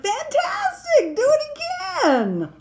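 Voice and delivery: high, loud cheering shout. Acted. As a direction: egg the dragon on with a big yell. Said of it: "Elizabeth shouted".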